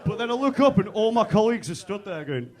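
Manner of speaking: ordinary talk, nothing marked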